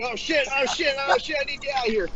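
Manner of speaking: high-pitched panicked babble; mock panic. Joking about waking to an awful alarm, mock panic. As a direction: act out scrambling away in alarm.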